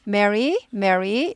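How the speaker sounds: Mary with a rising ending; kindly calling. Shown as a demonstration. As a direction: lift the end of Mary to call kindly. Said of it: "이렇게 되죠". (친절하게 Mary 끝을 올려 부르기)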